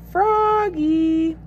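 high, drawn-out proud call; stretched, sung-out name; reading out loud. A narrator's reading. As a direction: belt out the name in two long notes. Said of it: "He read it out loud, louder and louder".

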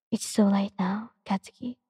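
breathy whisper, turning him down gently; whispered refusal. Acted. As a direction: whisper it as if holding back tears. Quiet tearful refusal in story.

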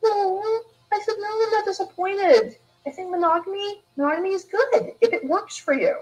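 reassuring mom. Retold or imitated. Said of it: "I just went".

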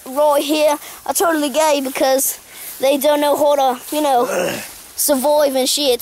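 hammed-up Australian bush guide, disgruntled; stranded Australian survival host. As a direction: address viewers in a broad mock Aussie accent.

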